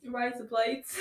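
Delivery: "blades" as "blites" in mock Australian; laughing Australian-accent "Razor blades", rising at the end. Showing friends her one other accent, laughing mock Australian. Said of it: "Do a different accent".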